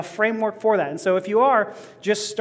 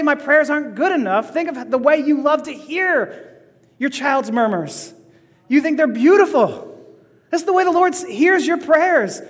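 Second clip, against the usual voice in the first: higher, lifted preaching voice; impassioned preaching; 6 semitones higher, 3 dB louder than usual. Real, not acted. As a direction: preach it with rising urgency, pitched up. Preacher drives home the point.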